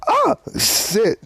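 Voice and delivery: high, startled swear; panicked yelp. Real, not acted. Storyteller nearly falls mid-story, real panicked yelp. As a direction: yelp it out as you slip. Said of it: "before I panicked".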